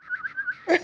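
very high shrill whistle; showing friends his whistle trick, piercing and high; whistle demo. Shown as a demonstration. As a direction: whistle sharply like calling someone over. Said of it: "I do this whistle. I'll try to do it".

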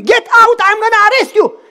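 high, loud retold scream at the visitor; a furious mock shout of get out. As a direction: yell it like an angry policeman. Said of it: "started to scream".

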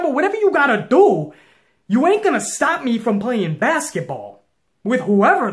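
high, loud defiant yell at the guard; rising into a full-throated challenge. Retold or imitated. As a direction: shout him down, daring anyone to stop you. Retold defiant reply to the guard.

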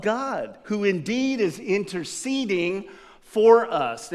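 slow, solemn Bible reading; lightly heightened scripture reading. Acted. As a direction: read the verse with weight.

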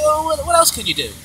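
very high mock eagerness; eager onlooker. Imitating impressed onlookers asking.

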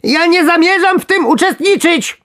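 very high, loud squeaky protest; desperate shouting. A narrator's reading. Worm refusing to take part, desperate shrieking. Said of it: "krzyczał rozpaczliwie robak".

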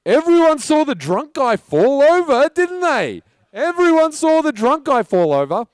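high, loud announcement to bystanders; acted loud protest. Actor defending himself to staring neighbors, acted loud protest. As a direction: call out an insistent alibi for all to hear.

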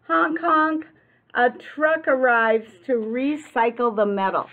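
high, loud sound-effect words; noisy sound words. A narrator's reading. Reading the demolition noises to kids, loud and high. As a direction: bang out the crash words with gusto.

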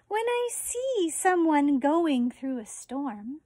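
slow, gentle storybook read; reading to children. Acted. Teacher reading a book on compassion to her fourth graders, gentle read-aloud. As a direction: read it tenderly to the class, pausing often.

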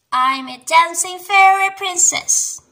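high, loud storybook announcement; excited cry. A narrator's reading. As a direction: proclaim it excitedly to an audience. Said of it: "cries Peppa".

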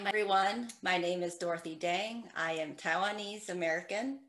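usual speaking voice

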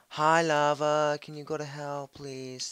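fake-nice sarcasm; sarcastic politeness. Mock-polite talk to lava.

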